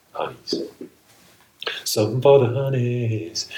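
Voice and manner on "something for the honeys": high, loud playful croon; flirty. Real, not acted. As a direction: tease the honeys.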